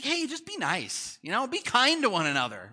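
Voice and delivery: high-pitched "just be nice" plea; imitated peacemaker. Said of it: "we're just like".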